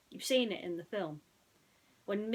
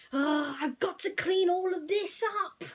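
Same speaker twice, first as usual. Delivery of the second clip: high, loud fretting car voice; Ramon crying out; 10 semitones higher, 7 dB louder than usual. A narrator's reading. Painter fretting over his messed-up shop, high and loud. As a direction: fuss over the mess loudly. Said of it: "Ramon cried".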